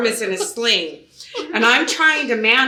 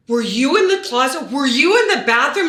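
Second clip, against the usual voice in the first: re-enacted alarm, higher and rising in volume; shocked questioning; 5 semitones higher, 5 dB louder than usual. Realtor confronting the man in the house, shocked. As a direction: fire off both questions as the truth dawns.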